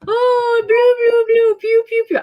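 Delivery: high silly derpy toy voice; goofy high toy sound effects. Pretend. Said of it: "We're gonna do it all derpy".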